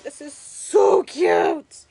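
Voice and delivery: loud, slow, drawn-out gush of delight; real thrilled shout over a cute result; delighted squeal. Real, not acted. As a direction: squeal it like the reveal just won you over. Reveals finished craft, delighted.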